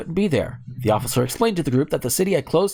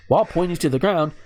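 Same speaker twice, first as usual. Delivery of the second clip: ordinary delivery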